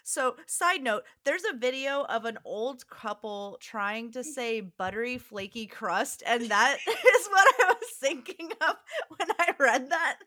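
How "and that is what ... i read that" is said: high, loud talk through laughter; real giggles breaking into speech; laughing. Real, not acted. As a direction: crack up while explaining. Laughing while explaining the distraction.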